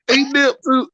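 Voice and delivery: ordinary delivery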